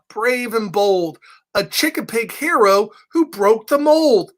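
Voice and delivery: ordinary delivery, nothing marked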